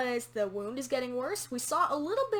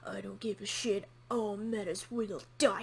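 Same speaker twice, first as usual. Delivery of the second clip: mock sinister villain; 4 semitones lower than usual. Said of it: "trying too hard to be this sinister guy".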